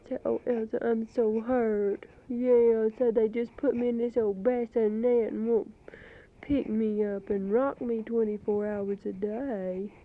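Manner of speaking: high, loud sing-song cooing to a crying infant. Real, not acted. Mother soothing her crying baby daughter on tape, loud high baby talk.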